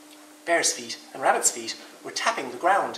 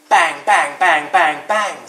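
much louder pecking bangs; Woodpecker banging; 3 semitones higher, 11 dB louder, much slower than usual. Acted. Woodpecker joining the music with loud bangs. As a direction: beat out each bang like a beak. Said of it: "said Woodpecker".

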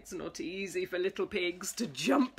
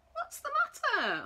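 concerned high question. Acted. Pig asks crying mouse.

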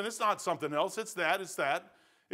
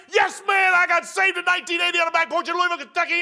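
high, loud, rapid-fire shout; overjoyed testimony; 11 semitones higher, 9 dB louder than usual. Retold or imitated. Believer happily answering whether he is saved, excited shout. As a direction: burst out with exaggerated eagerness.

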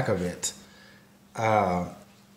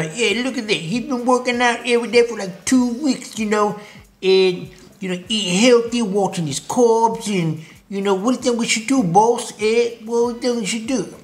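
high, hammed-up Italian mobster accent; Canadian Italian mafia; 8 semitones higher than usual. Fat cells asking their boss what to do, bad Canadian Italian accent. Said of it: "They're Canadian Italian mafias".

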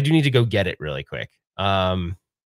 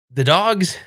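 cooing about dogs; 6 semitones higher than usual. Real, not acted.

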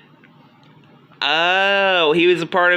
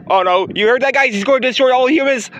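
usual speaking voice

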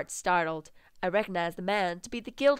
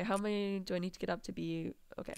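ordinary talk, nothing marked

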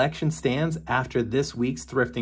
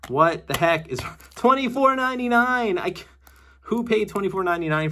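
shocked at the price; 8 semitones higher than usual. Real, not acted. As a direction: sputter at the price tag. Shocked at old price tag.

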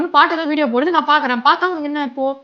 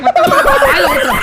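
much higher, slow, laughing; sarcastic squeal; 7 dB louder, slower than usual. Acted. Husband mocking wife's request about milk, high slow sarcastic squeal. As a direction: stretch it out in a squeaky mock voice.

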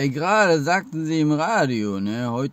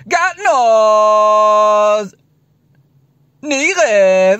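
backwards speech; 8 semitones higher, 8 dB louder, much slower than usual. Shown as a demonstration. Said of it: "Dann machen wir jetzt mal rückwärts".

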